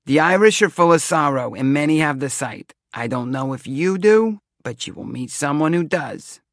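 high, loud Romany seer; thick Romany accent. A narrator's reading. Seer pronounces on the Irish to Devin, thick Romany accent. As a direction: declaim like a stagy Romany fortune-teller. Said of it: "talked in a thick Romany accent".

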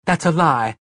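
loud, high outraged cry; screamed protest. A narrator's reading. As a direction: scream the denial at him. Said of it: "she screamed".

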